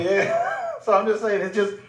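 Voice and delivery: giggling while speaking; laughing. Real, not acted. Still laughing after joke.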